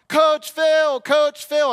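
loud, very high mock woman's shout; female voice calling. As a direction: imitate a woman hollering from a car. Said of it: "I hear a female voice from a car".

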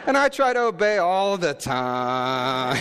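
a hint of a small boy; lightly voiced little boy. Voicing son's reply.

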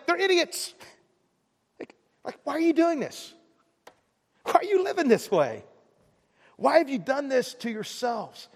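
high-pitched, incredulous scolding of the crowd; exasperated judgment. Acted. Preacher voices own judgmental thoughts.

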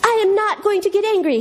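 high-pitched cartoon superhero holding firm; mock-heroic refusal; Larry Boy. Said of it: "this is Larry Boy Plungers".

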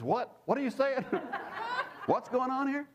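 mock-indignant squeal of disbelief, laughing. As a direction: act out jealous shock at someone's good luck. Retold indignant shock at news.

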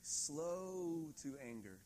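drawn out, very slow; slow and deliberate. Shown as a demonstration. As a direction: lean on each word, slowly. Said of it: "Graham Cook says it like this".

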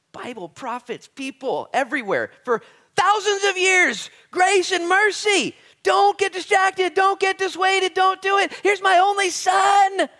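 slow, high, full-throated shouting; impassioned divine plea. Acted. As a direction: build to a drawn-out bellow. Voicing God's impassioned reply.

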